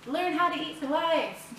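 high, slow, cheesy ad voice; mock marketing slogan. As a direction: sell it like a slick ad. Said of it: "like, marketable to be like".

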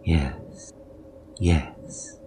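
soft, whispered yes; quietly. A narrator's reading. Wife agreeing to revenge, quietly whispered. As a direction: murmur it quietly, almost gone. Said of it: "she said quietly".

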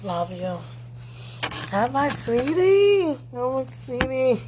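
high, loud, slow gushing greeting. Real, not acted. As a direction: coo it out, delighted.

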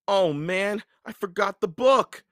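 high, loud dismay; dismayed exclamation. A narrator's reading. Jack sees he left the book behind, dismayed. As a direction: cry out at a sudden realisation.

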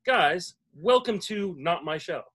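roll-call of guests, announcer style; projected, ceremonial show welcome; podcast host announcement. Acted. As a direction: introduce each guest like an emcee.

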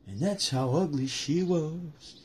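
slow, processed sting; a dragged-out echo catchphrase; slowed meme voice; slowed-down tagline. Pretend.

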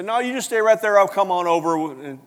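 lightly acted call to stay put; hoped-for reassurance. Jesus as Peter hoped, telling him to stay, calling out.

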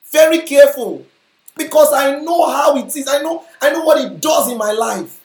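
impassioned preaching. Real, not acted. Preacher urging the congregation to guard their hearts, impassioned. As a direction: preach it forcefully from high in the voice.

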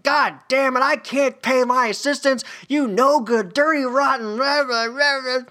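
high, loud hammed-up tirade; mock rant. Coach ranting at his payroll, high hammed-up fury. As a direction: blow up in a cartoonish fit. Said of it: "he goes on a rant".

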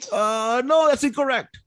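put-on high Vietnamese-accented sergeant major. Sergeant major rejecting the answer, Vietnamese accent.

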